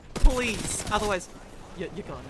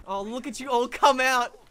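ordinary delivery

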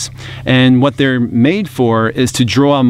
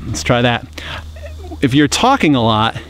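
ordinary talk, nothing marked

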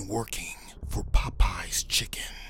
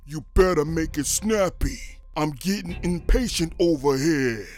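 loud, higher gangster warning; impatient mafia threat; 8 semitones higher, 8 dB louder than usual. Acted. Mobster telling the manager to hurry in a loud threatening voice. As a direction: lean in and pressure him.

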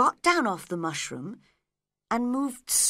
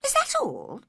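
restrained annoyance. A narrator's reading. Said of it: "trying not to be angry".

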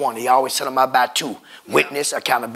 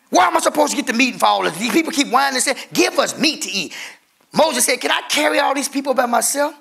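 half-sung preacher's chant, higher; sing-song preaching; 8 semitones higher than usual. Acted. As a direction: chant Moses' question to the church. Sung preaching of Moses' words.

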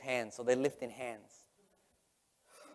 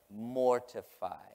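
"Mortify" drawn out slowly, syllable by syllable; slow, deliberate MOR-TI-FY; savoring the word; 2 semitones lower, much slower than usual. Real, not acted. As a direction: stretch out "Mortify" to relish it. Savours the word he loves.

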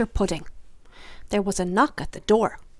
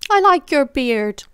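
put-on slow, louder, higher girlish voice; sweet little miss; 5 semitones higher, 9 dB louder than usual. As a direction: coo it slowly like a sly compliment. Teasing Mr Fussy.

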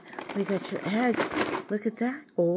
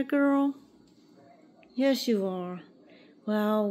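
usual speaking voice

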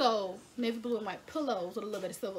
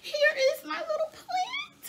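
excited squeal; 4 dB louder than usual. Real, not acted. Showing the plant she bought.

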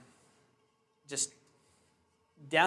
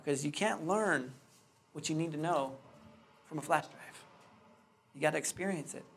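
usual speaking voice

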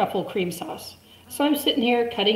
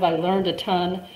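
ordinary delivery

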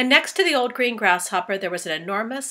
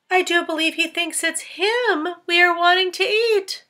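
high, louder character voice; amused insect; 10 semitones higher, 5 dB louder than usual. Acted. Insect realizing James fears being eaten, high and amused.

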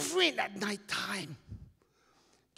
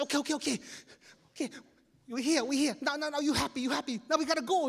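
nervous pleading; 9 semitones higher, faster than usual. Retold or imitated. Scared kid begging to leave.

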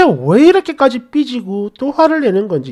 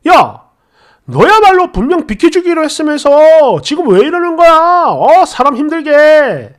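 louder, higher retold shout; angry yelling; 5 semitones higher, 5 dB louder than usual. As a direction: yell back at him, indignant. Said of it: "막 고함을 지르면서".

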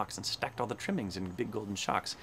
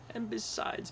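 high, softer storybook whine; plaintive cry; 9 semitones higher, 5 dB quieter, slower than usual. A narrator's reading. Poor Hubert protests to the tangled animals, crying plaintively. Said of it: "cried poor Hubert".

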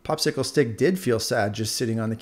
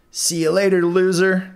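higher, louder mocking farewell; taunting; 7 semitones higher, 6 dB louder than usual. Earbuds mock Popsicle Stick as they leave, taunting. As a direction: sneer it as you leave.

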